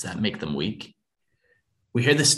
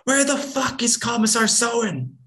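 high, loud enraged yell; raised voice; 3 dB louder than usual. Acted. Mother yelling at the lieutenant about the commissar, enraged. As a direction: let the rage boil over. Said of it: "I raise my voice".

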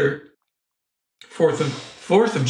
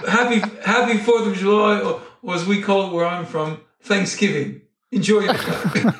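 mock British pilot announcement, breaking into laughs; bad British accent; 5 semitones higher than usual. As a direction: put on a poor British accent, amused and higher. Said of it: "that's my shitty British accent".

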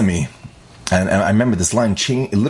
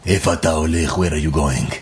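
lower, ominous mock voice; a hint of a threatening driver, played lower; mock menacing stranger; 5 semitones lower than usual. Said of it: "They didn't say it like that".

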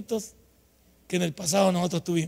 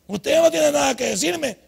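repeated more forcefully, higher and louder, stress on "no"; "no" hit hard, like a defiant child; desafiante; 6 semitones higher, 4 dB louder than usual. Retold or imitated. Repite la frase más fuerte. (en tono desafiante, recalcando "no")

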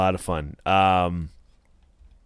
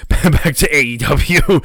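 high, loud, slower through laughter; laughing correction; 7 semitones higher, 6 dB louder, slower than usual. Real, not acted. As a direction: laugh off the slip as you correct it. Laughing correction after near slip.

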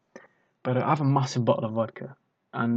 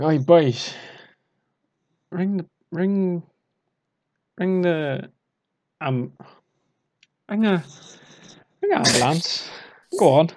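put-on Valleys accent; 5 semitones higher, 4 dB louder than usual. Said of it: "speaking in a thick Valleys accent".

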